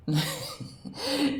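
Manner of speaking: a short high burst of laughter; bright, high real laugh. Real, not acted. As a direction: laugh it off, amused and dismissive. Laughs at the suggestion.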